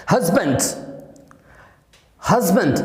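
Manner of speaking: "हस्बैंड" with stressed first syllable and short weak second; "हस्बैंड" as a careful teacher's model; correct pronunciation. Shown as a demonstration. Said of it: "इसकी right pronunciation है".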